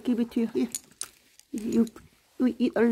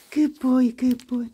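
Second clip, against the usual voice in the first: ordinary talk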